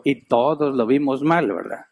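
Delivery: ordinary talk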